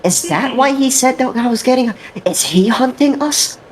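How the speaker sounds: high, frantic squeal of alarm; panicked Yamato. Retold or imitated. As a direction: squeak it out like he just realised someone tried to kill him. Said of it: "It's like".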